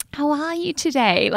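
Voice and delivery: high-pitched imitation of proper English; proper English accent. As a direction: mimic very proper English speakers. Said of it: "like, proper English".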